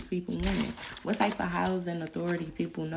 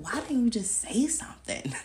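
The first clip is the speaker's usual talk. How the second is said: whispered scolding; 6 semitones higher than usual. Retold or imitated. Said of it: "she'd be like".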